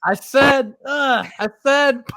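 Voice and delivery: high, loud pretend holler; mock shouting. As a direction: shout as if over gunfire, jokingly. Mimics shouting over gunshot noise.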